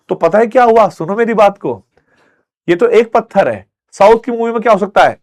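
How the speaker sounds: ordinary delivery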